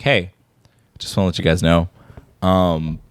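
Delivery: low, halting confession; lightly voiced apologetic neighbor; sheepish neighbor confession. Neighbor confessing to running over cat.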